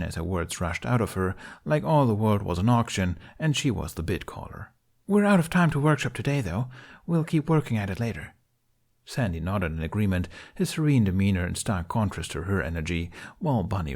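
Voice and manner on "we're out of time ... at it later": high, fast, chipper woman; frantic upbeat. A narrator's reading. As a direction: rush the words out excitedly. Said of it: "upbeat way of speaking that was borderline frantic".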